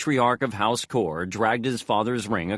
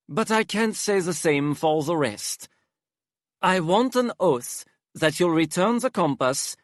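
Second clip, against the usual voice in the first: high, theatrical aristocrat; dramatic Hypnos voice; 7 semitones higher than usual. Acted. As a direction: flamboyant and weary, sighing exaggeratedly.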